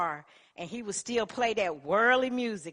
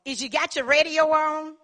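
loud, higher demand; bossy radio voice; 9 semitones higher, 11 dB louder than usual. Retold or imitated. Supervisor demanding over the radio, loud and bossy. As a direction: bark the question down the line.

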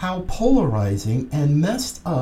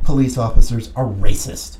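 usual speaking voice

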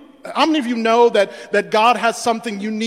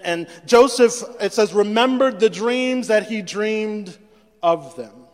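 ordinary delivery

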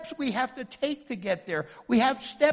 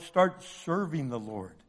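ordinary delivery

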